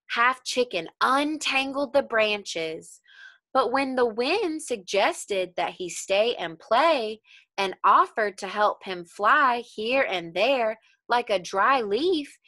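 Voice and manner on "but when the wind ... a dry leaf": ordinary delivery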